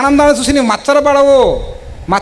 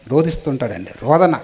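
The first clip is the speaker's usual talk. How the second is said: రోదిస్తుంటాడండి repeated much lower and softer, almost to himself; hushed echo; 8 semitones lower, 5 dB quieter than usual. Shown as a demonstration. Preacher echoes that God weeps, lower and hushed. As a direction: let the word రోదిస్తుంటాడండి sink into a low hushed murmur. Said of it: "Bible చూస్తే రోదిస్తుంటాడండి".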